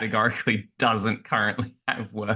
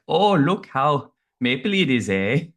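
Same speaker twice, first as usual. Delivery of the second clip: quick mock Canadian accent, raised up; tossing off a jokey Canadian "eh"; 5 semitones higher, 3 dB louder than usual. Speaker mocking maple-washing brands in a Canadian accent. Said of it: "pretty good Canadian accent there".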